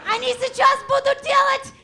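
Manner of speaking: loud, high, slow shout in Russian; frantic shouted Russian to grandpa. Retold or imitated. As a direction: yell it across the room in Russian.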